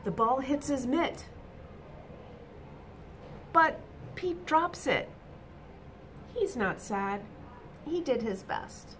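bright, lively storybook reading; reading to children. A narrator's reading. Teacher reading a picture book to children, animated. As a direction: read it out to the little ones with energy.

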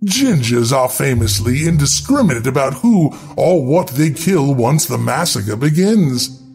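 ordinary delivery

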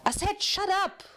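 irritated prisoner yelling at the noisy cellmates; angry yell. Acted. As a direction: yell it up through the floor, annoyed. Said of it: "cried one of the prisoners from below".

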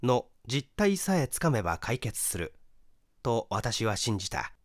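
ordinary delivery, nothing marked

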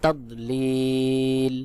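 তদলিল with the final ee held very long; slow recited তদলিল, long steady last syllable; correct elongated pronunciation. Shown as a demonstration. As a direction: stretch the লি of তদলিল for three alif counts before closing on l. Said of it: "সহি উচ্চারণ হবে".

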